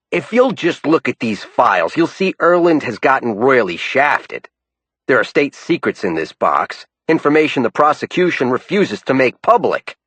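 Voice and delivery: high, pushy raspy New Yorker; New York accent. A narrator's reading. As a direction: haggle hard, New York style. Bell's dialogue.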